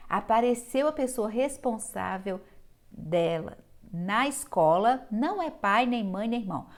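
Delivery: ordinary delivery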